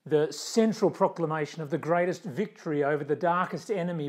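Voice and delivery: full-force Easter preaching; Easter proclamation. Acted. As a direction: proclaim it to the whole church.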